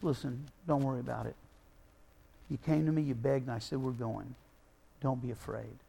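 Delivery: soft, low, calming Jesus voice, hushing his fear; quietly comforting a frightened father; gentle reassurance. Retold or imitated. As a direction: speak him down from panic, softly.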